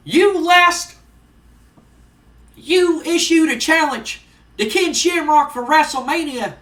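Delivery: high, loud ringside harangue; wrestling manager promo. Acted. Jim Cornette confronts Vader from the ring, loud scornful promo. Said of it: "he gets into the ring and he goes".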